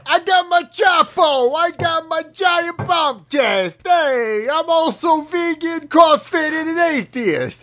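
high, drawn-out New Jersey shouting; mock New Jersey accent. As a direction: boast in a hammed-up Jersey accent. Plays Jersey vegan CrossFit atheist, per joke.

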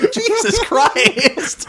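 high, drawn-out cry through laughter; laughing exclamation. Real, not acted. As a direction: exclaim helplessly while cracking up. Cracking up at a silly joke.

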